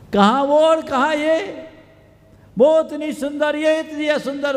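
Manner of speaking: very high, loud outburst; acted dismay. Suitor sees the sister and compares, dismayed. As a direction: exclaim it like a retold shock.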